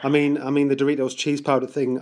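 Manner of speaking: usual speaking voice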